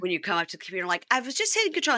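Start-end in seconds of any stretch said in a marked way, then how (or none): 1.1-2.0 high, meek excuse; sheepish office worker. Retold or imitated. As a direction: make a timid excuse. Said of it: "Jimmy Fallon was the computer tech guy".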